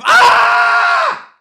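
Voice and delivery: very high, loud scream; cathartic scream. Shown as a demonstration. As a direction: erupt into a long venting scream. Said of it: "Wow, this feels good".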